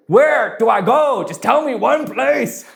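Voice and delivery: loud, high, slow mock yell; pleading comic outburst at full volume; exasperated shout. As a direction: yell it in hammed-up frustration, word by word. Acted outburst of confused church member.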